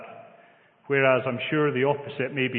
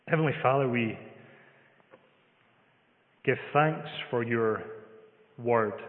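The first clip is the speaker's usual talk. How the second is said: prayer. Real, not acted. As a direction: pray aloud, feeling for each phrase. Leads the congregation in prayer.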